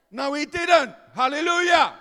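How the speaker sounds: loud, climbing preacher's delivery; full-force preaching. Acted. Preacher telling the congregation the friend's testimony, full-force preaching.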